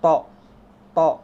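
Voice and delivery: "To" with pouted mouth; heavy, rounded "To"; rounded lips. Shown as a demonstration. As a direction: push lips forward on "To". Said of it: "Mulutnya harus monyong".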